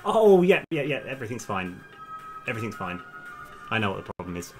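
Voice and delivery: usual speaking voice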